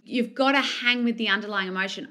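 normal talking voice

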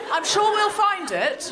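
high, light-voiced official, lightly done; mock-airy civil servant reassuring; breezy official. Home Office clerk brushing off the caller, lightly mock breezy. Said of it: "she said".

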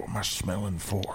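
whispered question in the dark cave. Acted. Adventurer whispering to the slayer in the cave. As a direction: hush it so the monster won't hear. Sneaking close to the monster.

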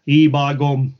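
lightly put-on Yorkshire accent. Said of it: "proper Yorkshire".

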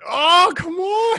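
high, slow, drawn-out mock cry; mock wail. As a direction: wail it like it hurts. Slogan pun dreaded again.

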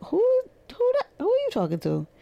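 who do you think you're talking to; offended disbelief. Retold or imitated. To a rude coworker on the phone, shocked and offended.

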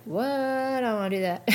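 exaggerated reluctant whine; mock whining protest. Acts out balking at therapist's advice.

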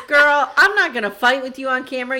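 loud, sassy imitated comeback; sassy retort. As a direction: shut her down loudly, playing the part. Acted retort in retold quarrel.

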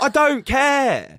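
loud, high, stretched-out words; exasperated acted shout; angry dismissal. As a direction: re-enact yelling it in his face. Retold shouting back.

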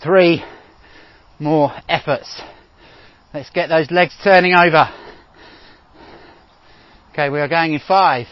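normal talking voice

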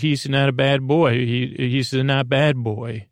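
usual speaking voice

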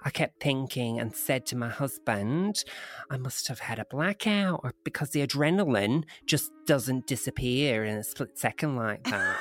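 lightly put-on Australian accent. Woman recounting a UFO encounter, in a rough Australian accent. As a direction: read it as an Australian witness. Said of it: "doing single-handedly to the Australian accent".

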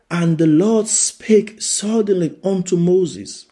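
high, loud proclaiming of the verse; preaching at full force. Acted. Preacher reading scripture to the congregation, proclaiming. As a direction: boom it out like a preacher.

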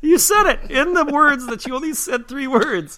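loud, high-pitched incredulous rant; exasperated ranting. Real, not acted. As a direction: scold the co-host in outraged disbelief. Exasperated correction of wrong movie.

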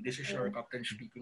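low, lightly voiced airline captain; a hint of a deep pilot announcement; tito pilot voice. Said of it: "yung titong magsalita".

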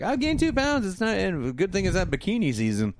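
high-pitched mock fretting; concerned. Kelly to Donna in the bathroom, concerned. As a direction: imitate a worried girl, voice jumping up. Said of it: "Kelly is concerned 'cause she's gained".

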